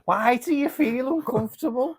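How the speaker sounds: very high, loud Scouse question; Scouse accent. Shown as a demonstration. Pressing an uneasy friend for the reason, in Scouse. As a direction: press them in a put-on Liverpool accent. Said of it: "I'm doing this accent".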